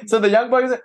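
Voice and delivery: a light laugh as the line starts. Real, not acted. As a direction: chuckle through the words.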